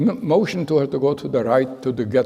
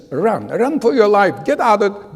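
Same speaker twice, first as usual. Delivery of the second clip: loud, higher urgent cry; urgent warning; 7 semitones higher, 5 dB louder than usual. Retold or imitated. Guard urging the boy to run for his life, loud and urgent. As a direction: push him to flee at once.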